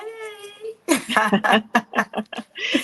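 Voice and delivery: high, loud burst of laughter; delighted high cackle. Real, not acted. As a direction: burst out laughing as you greet. Greeting each other on stage.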